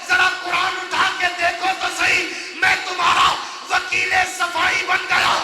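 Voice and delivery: loud, building sermon cadence; full-force preaching. Acted. Preacher voicing the angels' protest to God, full-force preaching. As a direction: drive it home to the crowd.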